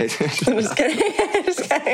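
laughing it off. Real, not acted. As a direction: giggle through the words. Teasing after wrong name.

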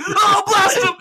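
high, loud frantic order to fire; frantic shout. Retold or imitated. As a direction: yell at the team to shoot. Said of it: "and then I'm like".

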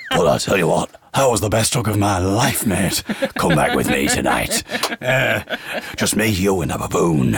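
joking about the helper, in a hammed-up low voice; comic deep voice. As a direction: croon it like a cheesy charmer.

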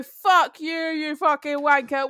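imitating an angry driver's insult; mock angry driver. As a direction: spit it out like the rude driver. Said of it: "look at you and go".

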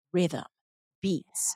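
soft single words with pauses; inner mantra. Retold or imitated. Recalling the word that kept coming through, hushed mantra. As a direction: drop each word softly, spaced apart. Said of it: "the one word that kept coming through".

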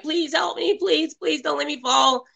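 high, soft begging; mock pleading. Rose dangling from the roof, begging for help, high and soft. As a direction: beg not to be dropped, up high and hushed. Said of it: "'cause she's like".

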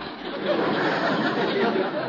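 high, breathy embarrassed chuckle; long high chuckle; nervous chuckle. Acted. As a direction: laugh it off, flustered and high. Gildersleeve's reaction laugh.

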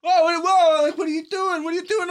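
very high, alarmed mock yelling; high-pitched panicky objection, loud at the start; retold yelling. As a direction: act out a startled man crying out in protest. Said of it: "he's all of a sudden yelling".

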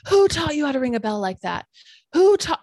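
loud and rising into a high, loud shout; building angry yell. Retold or imitated. Old man repeating his rebuke, building to a high, loud shout. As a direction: get angrier with each repeat. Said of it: "he's getting angrier".

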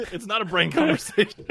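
loud laughing protest. Real, not acted. Laughing retort.

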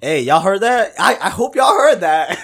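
high, loud excited call; excited shout. Real, not acted. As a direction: hype up the listeners. Host hypes the free giveaway.

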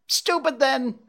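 very high-pitched jay's taunt; laughing jeer. A narrator's reading. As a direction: toss it out mockingly, way up high. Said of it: "the jay laughed".

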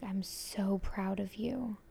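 slow, tender whispered words. Retold or imitated. Retelling the spirit's praise to her, in a slow whisper. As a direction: let each word barely come out on breath. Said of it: "And she was just like".

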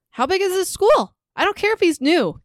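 high, exasperated disbelief; incredulous. Real, not acted. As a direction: pitch shoots up in disbelief. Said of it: "And I ask again".

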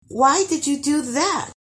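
put-on high-pitched girlish complaint; protesting, pitched well up; complaining. A narrator's reading. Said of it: "complained Guri".

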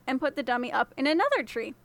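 ordinary delivery

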